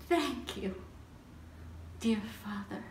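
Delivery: hushed gratitude. Acted. Instructed words of thanks.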